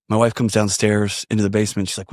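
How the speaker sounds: usual speaking voice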